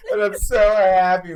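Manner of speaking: crying with joy recalling the wedding; tearful. Real, not acted. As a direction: choke up with happy tears. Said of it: "I'm getting all emotional right now".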